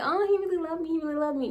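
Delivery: high, light gushing voice; lightly sing-song, dreamy mockery; mock smitten. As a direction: imitate your naive younger self swooning. Mocking her smitten younger self.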